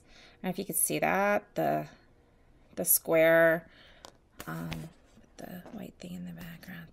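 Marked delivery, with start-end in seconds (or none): 4.5-6.9 whispering. Real, not acted. Aside while showing item.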